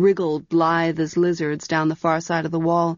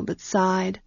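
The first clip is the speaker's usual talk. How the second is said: ordinary delivery, nothing marked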